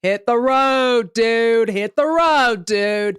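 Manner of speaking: slow drawling hit the road dude. Retold or imitated. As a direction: shoo them off lazily. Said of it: "he turns to the kids and goes".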